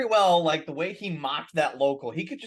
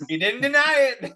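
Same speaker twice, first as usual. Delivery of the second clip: said through laughter, higher; laughing; 8 semitones higher than usual. Real, not acted. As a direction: laugh while accusing.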